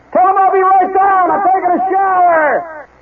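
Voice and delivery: high, strained holler from the shower; frantic yell back. Retold or imitated. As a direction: shout it downstairs, stretching the last words. Dad yells back from the shower.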